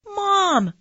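put-on high, loud child's yell; excited yell. Boy to his mom on seeing a dinosaur, yelling high and loud. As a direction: yell up high for your mother. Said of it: "I yelled".

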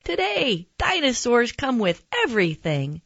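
high, excited nurse voice; bright announcement. Acted. Nurse explaining the special day, announcing it excitedly. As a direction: start way up high and announce it gleefully.

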